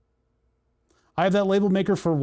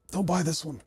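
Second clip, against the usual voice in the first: hiccups; 6 dB quieter than usual. Real, not acted. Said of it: "Sorry, I'm hiccuping".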